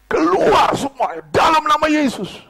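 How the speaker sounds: loud, high shouted exorcism command; reenacted shout. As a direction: cry it out as if casting out spirits. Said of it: "teriak begini". (teriakan lantang menirukan perintah pengusiran)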